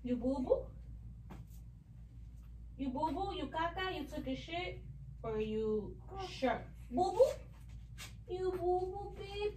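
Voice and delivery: put-on soft, sing-song baby talk. Mother coos soft questions to her baby. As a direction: coo at the baby softly.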